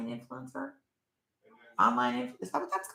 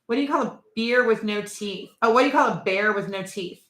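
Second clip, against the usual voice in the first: usual speaking voice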